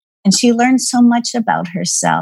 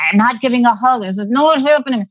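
higher-pitched mock refusal; retold annoyance; 4 semitones higher than usual. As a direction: act out a mildly mad parent digging in. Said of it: "I was getting mad".

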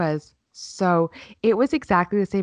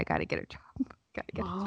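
whispered to herself with a laugh; soft laughing whisper; 11 dB quieter, much faster than usual. Real, not acted. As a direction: breathe it out, resigned. Whispered aside to self.

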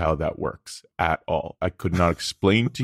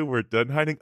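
mock nervous alien; 7 semitones higher than usual. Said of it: "they're like".

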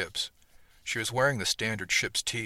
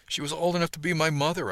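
higher, louder indignant protest; embarrassed protest, voice up and louder; 8 semitones higher, 4 dB louder than usual. A narrator's reading. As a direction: push back, a bit scandalized. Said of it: "I protested".

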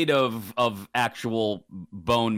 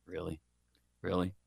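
incredulous mutter; 7 semitones lower, 9 dB quieter than usual. Retold or imitated. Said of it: "It's like".

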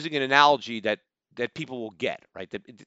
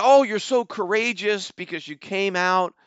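sarcastic gushing, pitched up; mock-admiring coo, higher; mock praise; 5 semitones higher than usual. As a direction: parody fans fawning over a hero. Mocking the guy's fans.

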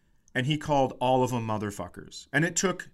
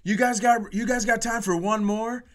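higher, hyped rock frontman yell; rock star stage shout; 8 semitones higher than usual. Retold or imitated. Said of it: "And then he goes".

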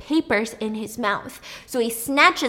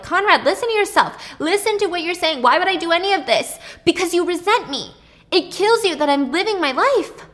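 heated pleading; 9 semitones higher, 6 dB louder than usual. Retold or imitated. Brothers shouting argument.

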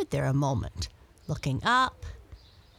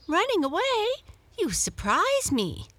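much higher, astonished; surprised cry; 13 semitones higher, 3 dB louder than usual. A narrator's reading. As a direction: Muffins can't believe what she hears. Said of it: "cried the other cat".

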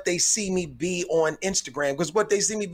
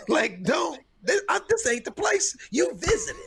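high, laughing while scolding; laughing rant; 13 semitones higher than usual. Real, not acted. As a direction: break into giggles mid-complaint. Ranting about house guest.

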